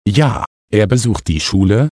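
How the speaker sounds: ordinary talk, nothing marked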